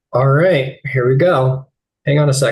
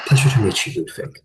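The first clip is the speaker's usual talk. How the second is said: otherworldly voice; faster than usual. Acted. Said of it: "Vestige's voice comes from your mother's mouth".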